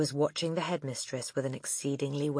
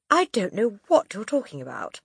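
puzzled; 6 semitones higher, 5 dB louder than usual. Acted. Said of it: "more puzzled than ever".

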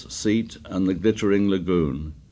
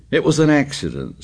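stubbornly; 7 semitones higher, 3 dB louder than usual. A narrator's reading. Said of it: "said Piggy stubbornly".

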